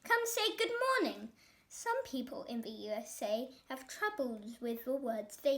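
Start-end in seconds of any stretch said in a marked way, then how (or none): 0.0-1.3 loud, high, fast command; a boy ordering his pet; bossy shout; yelling. A narrator's reading. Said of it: "he yelled".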